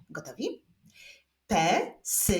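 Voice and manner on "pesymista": syllable by syllable. Shown as a demonstration. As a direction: clap it out beat by beat. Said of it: "Zapraszam do wyklaskiwania sylab w tym wyrazie".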